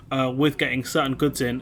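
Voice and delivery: ordinary delivery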